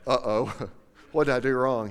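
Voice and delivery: loud, laughing mock panic, like being pulled over; mock alarm. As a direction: blurt it out with a nervous laugh, caught out. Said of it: "got that same feeling you get when the blue lights come on".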